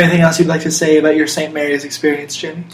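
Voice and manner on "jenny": usual speaking voice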